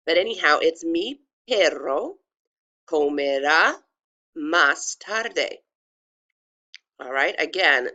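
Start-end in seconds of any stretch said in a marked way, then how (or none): 0.8-5.6 slow Spanish, attempting a trill on the double r of "perro"; pronunciation demo. Shown as a demonstration. Teacher showing the tricky trill, slow careful demo. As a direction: sound every letter of "Mi perro comerá más tarde", final e of "tarde" voiced. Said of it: "this would be pronounced".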